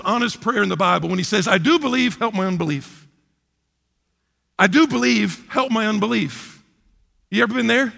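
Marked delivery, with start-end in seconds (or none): 1.5-6.6 crying out. Retold or imitated. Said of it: "the father cries out".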